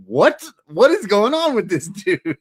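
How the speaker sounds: high, loud incredulous outburst; baffled cry breaking into a laugh; laughing disbelief. Acted. Co-host reacting to the bizarre wrestler, loud amused disbelief.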